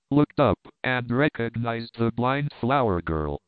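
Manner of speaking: ordinary delivery, nothing marked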